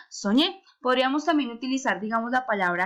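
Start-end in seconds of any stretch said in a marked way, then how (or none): 0.1-0.6 soñé rising onto a stressed ÑÉ; stress on last syllable. Shown as a demonstration. Teacher confirming the answer, soñé stressed on ÑÉ. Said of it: "Entonces, ¿cómo quedaría?".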